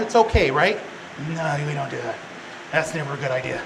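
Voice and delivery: normal talking voice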